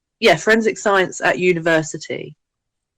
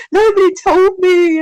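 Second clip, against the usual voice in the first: higher, a mock wail; upset friend; 13 semitones higher, 7 dB louder than usual. Friend ringing upset on the phone, mock wail. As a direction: play the distraught friend, half joking.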